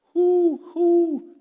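high, loud "who" hoots; ringing owl call on who. Shown as a demonstration. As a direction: hoot the word "who" like an owl. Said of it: "we gotta be owls".

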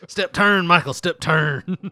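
loud, high drill instructor calls; bossy shouting. Pretend. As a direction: bark the dance steps loudly. Mock dance instructor barking.

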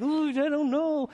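mock nervous high "Ooh"; hammed-up cautious churchgoer; wary hesitation. Conservative churchgoer wary of the Spirit, mock nervous. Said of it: "it's just like".

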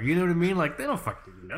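ordinary talk

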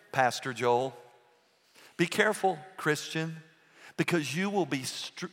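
ordinary delivery

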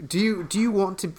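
put-on abandoned try at a British accent. Host fumbling into the movie line, wrong British accent. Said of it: "I was gonna do a British accent".

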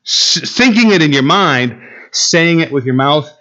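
normal talking voice

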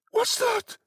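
high-pitched startled cry; alarmed old woman. A narrator's reading. Old woman sitting up quickly in bed, high alarmed cry. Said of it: "cried the old woman".

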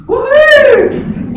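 loud, high whoop of delight; celebratory woohoo, high and loud; excited cheer. Acted. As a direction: whoop over a bargain find. Celebrating the jeans bargain find.

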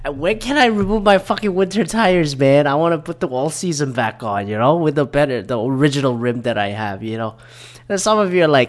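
ordinary talk

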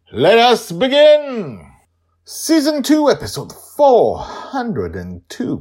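big, slow, high showman call; grand announcement. Acted. Host opening the episode with a grand announcement.

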